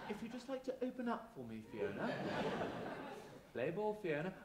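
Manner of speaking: soft creepy coaxing. Acted. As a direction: coax her gently, a bit sinister. Dentist coaxing audience member.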